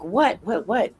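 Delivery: confused alarm, something's wrong; shocked. Retold or imitated. Husband waking suddenly, mock startled alarm. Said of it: "like, shocked, not what, like, angry".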